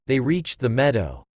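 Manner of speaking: ordinary delivery, nothing marked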